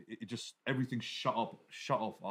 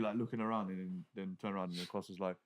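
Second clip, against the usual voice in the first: ordinary talk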